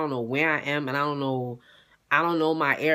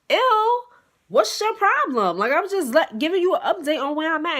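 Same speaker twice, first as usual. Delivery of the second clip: annoyed; 10 semitones higher than usual. Retold or imitated. Annoyed reply to boyfriend's call.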